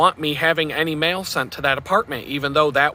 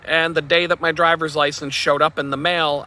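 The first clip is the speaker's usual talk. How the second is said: ordinary talk, nothing marked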